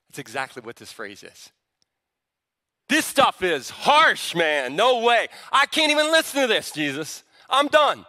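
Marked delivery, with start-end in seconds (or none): 2.9-8.0 loud and higher, a fed-up protest; indignant outburst. Acted. Disciples complaining to Jesus about his hard teaching, loud indignant protest. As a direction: throw up your hands, refusing to listen.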